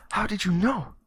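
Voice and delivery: put-on high-pitched astonished kid; freaked out. Kid to homeless man who knows the word, freaked out. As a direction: blurt it out in high-pitched disbelief. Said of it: "the kid freaks out".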